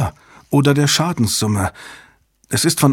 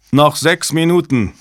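raised, higher countdown call; 5 semitones higher, 3 dB louder than usual. A narrator's reading. Technician warning the team of time left, calling out. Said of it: "ertönte der Ruf eines Technikers".

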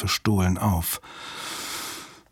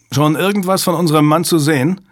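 higher-pitched call over the shoulder; 6 semitones higher, 4 dB louder than usual. A narrator's reading. Driver agent to partner in the back, calling out. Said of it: "rief der Agent am Steuer".

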